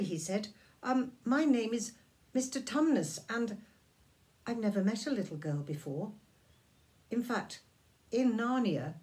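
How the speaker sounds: apologetic faun. Acted. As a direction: stumble over the apology. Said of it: "he took a step back".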